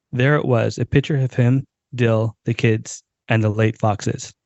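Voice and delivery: ordinary talk, nothing marked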